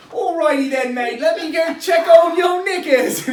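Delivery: hammed-up British accent, nearly shouting. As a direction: bellow a silly fake British bloke. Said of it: "so I'll just do as close as I can".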